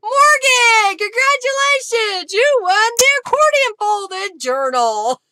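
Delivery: high, loud joyful congratulations; loud game show winner reveal; excited announcement. Real, not acted. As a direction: call out the winner in delight.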